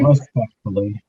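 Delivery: RESPECTFULLY echoed slowly, drawn out; emphasis on RESPECTFULLY. Retold or imitated. Fellow panelist echoing the warning, slow stress on RESPECTFULLY. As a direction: draw out RESPECTFULLY as a knowing echo. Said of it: "Emphasis on respectfully, okay?".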